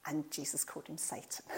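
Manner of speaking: whispered aside; a hushed whisper to the congregation. Real, not acted.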